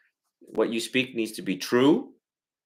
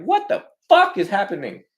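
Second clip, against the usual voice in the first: angry outburst; 9 semitones higher, 7 dB louder than usual. Retold or imitated. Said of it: "they'll come to this door and be like".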